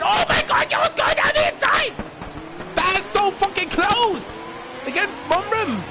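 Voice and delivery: shrieking excited call; motorsport commentator. Real, not acted. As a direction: scream it out high and loud. Close battle, yelling.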